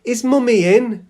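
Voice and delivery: put-on a caller asking a teen for his mum; old lady caller. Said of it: "me nan's friend used to ring".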